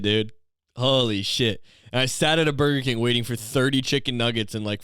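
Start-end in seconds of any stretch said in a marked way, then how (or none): none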